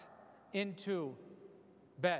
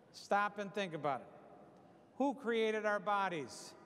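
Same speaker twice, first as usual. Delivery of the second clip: ordinary talk, nothing marked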